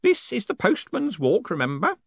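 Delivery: put-on high-pitched old postman; fussy elderly underling; urging. A narrator's reading. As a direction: remind him eagerly, pitched high. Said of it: "Groat urged".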